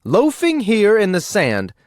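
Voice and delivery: loud, high scolding outburst; shrill-pitched nag at full volume; indignant exclamation. A narrator's reading. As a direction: scold him like a fed-up wife. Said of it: "she exclaimed".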